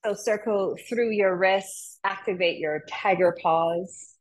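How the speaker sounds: slow, calm teacher cues; guided yoga instruction. Acted. Teacher guides the class through a mudra, calm and slow. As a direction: guide the class gently through each hand movement.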